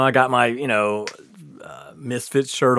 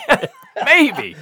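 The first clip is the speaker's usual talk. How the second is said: laughing hope; 12 semitones higher, 5 dB louder, slower than usual. Retold or imitated. Laughing, hoping it's the party.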